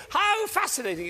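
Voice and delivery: very high, loud burst of delight; celebratory. Shown as a demonstration. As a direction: exclaim it jubilantly to celebrate a mistake. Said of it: "It's like this".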